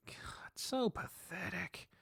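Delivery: slow whispered self-reproach; muttered self-disgust. Acted. Narrator scolding himself over unanswered messages, hushed and slow. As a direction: whisper it to yourself, drawn out.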